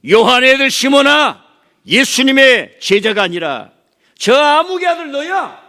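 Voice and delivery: high, loud scolding yell; furious rebuke. Acted. Jesus rebuking the preacher by name, furious shout. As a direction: tear into him like an angry master. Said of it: "이런 얘기예요".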